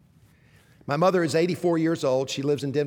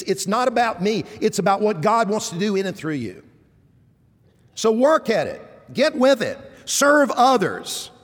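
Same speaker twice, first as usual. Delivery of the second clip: rising, louder exhortation; preaching; 5 semitones higher, 5 dB louder than usual. Acted. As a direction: urge the flock on, building.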